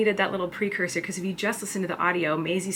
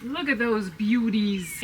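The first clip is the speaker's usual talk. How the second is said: admiring; 2 semitones higher, much slower than usual. Real, not acted. Admiring the polished rocks.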